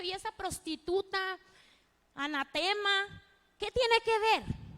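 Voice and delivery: imitating God's people condemning the prostitute, slow and high; scornful townsfolk. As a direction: sneer it like the crowd judging Rahab. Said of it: "El mismo pueblo de Dios pudo haber hecho".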